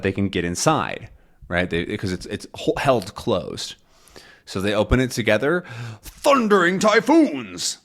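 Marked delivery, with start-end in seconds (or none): 6.0-7.8 high, slow exclamation in a put-on voice; astonished oath. Haddock opening the lifeboat, astonished. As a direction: draw out the oath in acted amazement.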